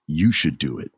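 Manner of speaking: a hint of a scheming champion; menacing Roman. Roman handing Sami the chair, low cold urging.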